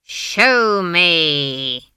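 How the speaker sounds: loud, long-drawn menacing demand; commanding shout. A narrator's reading. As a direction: thunder it, stretching both words. Final escalated command to frightened child.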